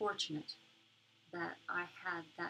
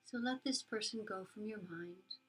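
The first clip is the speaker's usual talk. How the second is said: soft spa whisper; 4 dB quieter than usual. Acted. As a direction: guide them gently into calm. Leads a metta meditation.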